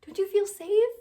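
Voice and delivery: high, mocking sing-song question; sarcastic taunt. Mocking the viewers with a sarcastic question. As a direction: needle them with fake concern.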